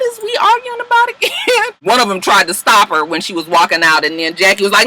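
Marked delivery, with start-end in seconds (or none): 0.0-1.7 high, airy confused voice, pitched way up; mock confused girl. The other girls sitting there confused, high airy puzzlement. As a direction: play a clueless bystander lost in the fight.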